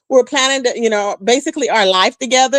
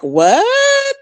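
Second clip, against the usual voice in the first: shocked what; 12 semitones higher than usual. Retold or imitated. Recalling his threat, a high shocked what. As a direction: squeal it in disbelief. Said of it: "And I was like".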